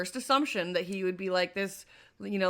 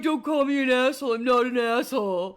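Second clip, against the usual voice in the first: louder, higher mimicry of boyfriend; mock indignant protest; 4 semitones higher, 6 dB louder than usual. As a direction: imitate his protest, pitched up and louder.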